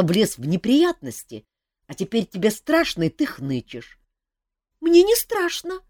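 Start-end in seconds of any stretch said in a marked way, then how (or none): 4.4-5.8 high, slow chick voice near sobbing; sniffling denial. A narrator's reading. Chick insists he's not scared, high sniffling. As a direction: deny fear through a sniffle. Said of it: "всхлипнул цыплячий голосок".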